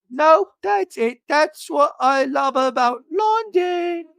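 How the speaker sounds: high, slow, badly faked British accent; [name]'s British imitation. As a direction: attempt a drawn-out, hammed-up British accent. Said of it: "trying to do a British imitation".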